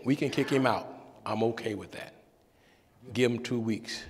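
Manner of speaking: a hint of a deep, calm reverend; deep-voiced pastor. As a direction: reverend answering the young intern, low and calm. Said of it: "with his deep voice".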